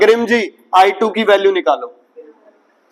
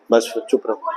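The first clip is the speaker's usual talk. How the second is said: hushed order; 10 semitones lower, 7 dB quieter than usual. Real, not acted. Teacher silences a student.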